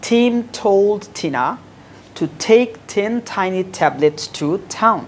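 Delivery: Tim told Tina with every T breathy; slow demo tongue twister; slow, aspirated Ts. Shown as a demonstration. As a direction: read the twister slowly, puffing each T. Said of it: "প্রথমে slowly পড়বা".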